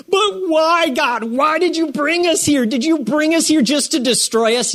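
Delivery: high, whiny complaint to God; whining Joshua. Retold or imitated. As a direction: whine it like a sulking leader.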